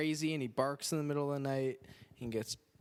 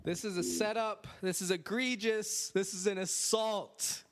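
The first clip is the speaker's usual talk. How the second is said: mock outrage; 6 semitones higher than usual. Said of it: "That's what John Malkovich says".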